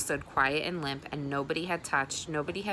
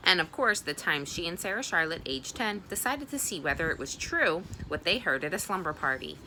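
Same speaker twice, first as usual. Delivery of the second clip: ordinary delivery, nothing marked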